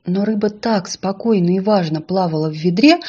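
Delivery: usual speaking voice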